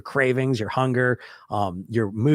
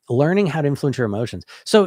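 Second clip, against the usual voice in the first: normal talking voice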